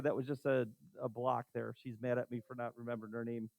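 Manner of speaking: low, very soft aside; quiet aside. Real, not acted. Teacher explaining a forgotten name to class, quiet aside. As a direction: drop right down as if confiding.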